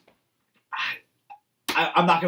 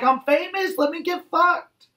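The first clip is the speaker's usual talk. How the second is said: much higher mocking girl voice; squeaky mock of a vain teen girl; 14 semitones higher than usual. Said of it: "She's just like".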